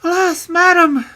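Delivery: very high, loud lamenting princess; distressed princess. A narrator's reading. Princess answering the old hag's suspicion, distressed.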